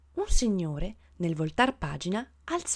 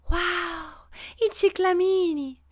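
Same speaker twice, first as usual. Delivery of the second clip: gasp, then high-pitched delight; gleeful exclamation; 10 semitones higher, much slower than usual. A narrator's reading. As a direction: marvel like an overjoyed lady. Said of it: "esclamò una signora tutta giuliva".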